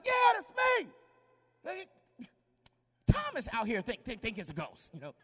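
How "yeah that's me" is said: high loud call; Jesus answering Peter from the water; calling back. Acted. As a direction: shout back casually. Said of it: "And Jesus like".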